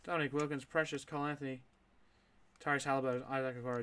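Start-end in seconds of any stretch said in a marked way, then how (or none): none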